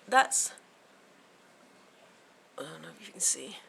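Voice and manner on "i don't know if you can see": soft, quick whisper. Real, not acted. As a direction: murmur it under your breath. Whispered aside.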